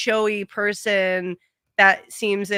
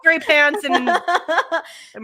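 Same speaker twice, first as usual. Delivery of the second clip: higher, louder mock scolding through laughter; mock annoyed guy; 7 semitones higher, 7 dB louder, slower than usual. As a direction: play the fed-up viewer telling her off. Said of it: "He's like".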